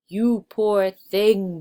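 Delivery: slow, loud, big booming voice; booming giantess. Acted. The giantess pities little Jack, slow and booming. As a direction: boom it down like a kindly giant woman. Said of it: "boomed the giantess".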